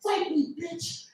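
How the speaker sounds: high, furious mock rant from a gamer; raging gamer. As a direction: play an angry kid screaming slurs down a mic. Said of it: "He's like".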